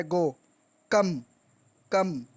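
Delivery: "गो", "कम", each in one single burst of sound; one-burst monosyllables. Shown as a demonstration. Teacher demonstrating monosyllables to learners, "गो" and "कम" each in one burst. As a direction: say "गो" and "कम" as one unbroken beat each. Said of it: "एक ही झटके से निकला है".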